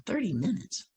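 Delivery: quiet disbelief, voice lifting at the end; baffled echo. Retold or imitated. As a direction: question it under your breath. Her puzzled thought echoing it.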